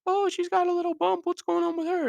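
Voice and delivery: very high falsetto gossip; mock everyone's curiosity in a squeaky high voice; put-on falsetto busybody; nosy onlookers. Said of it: "everyone's like".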